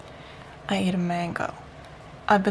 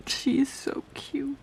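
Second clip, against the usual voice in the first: tearful; 6 semitones higher, 4 dB quieter than usual. Real, not acted. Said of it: "I'm gonna cry".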